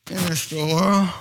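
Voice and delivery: re-enacted embarrassed mumble; sheepish admission. Husband confessing to wife where son was, sheepish. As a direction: own up reluctantly.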